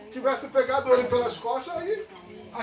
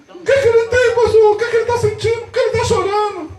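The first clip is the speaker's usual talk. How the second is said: high, urgent string of questions; frightened mother; 10 semitones higher, 4 dB louder than usual. Retold or imitated. Mother asking pastor why her son cries, frightened. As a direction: imitate a startled mother in a panic. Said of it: "a mãe dele se assustou".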